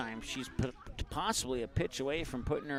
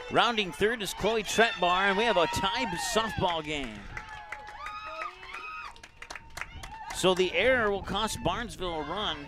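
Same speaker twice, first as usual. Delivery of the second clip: louder, rising with the play; excited play-by-play; 5 semitones higher, 4 dB louder than usual. Real, not acted. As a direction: call the tying run with a lift. Tying run scores on error.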